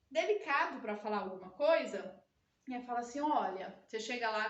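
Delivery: normal talking voice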